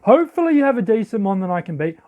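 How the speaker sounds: ordinary delivery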